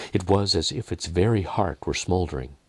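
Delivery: usual speaking voice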